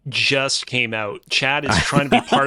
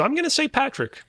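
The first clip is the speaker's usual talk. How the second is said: playful high pitch; 12 semitones higher than usual. Pretend. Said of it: "picks Patrick, higher take".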